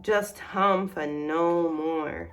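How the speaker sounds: usual speaking voice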